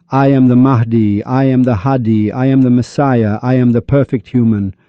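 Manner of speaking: normal talking voice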